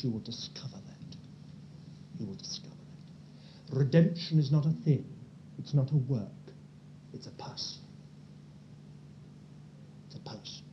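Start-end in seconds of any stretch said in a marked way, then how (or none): 0.0-3.8 soft hushed whisper; preacher's hushed whisper to the flock; whispered aside. Real, not acted. Dropped to intimate hush. 7.1-10.6 unvoiced intimate whisper; hushed repeated whisper for emphasis. Real, not acted.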